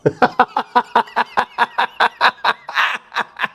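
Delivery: high, long cackling laugh; hearty laugh. Real, not acted. Storyteller cracks up over Donnie's four teeth, long high laugh. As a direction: crack up at your own story. Laughs at own gumming punchline.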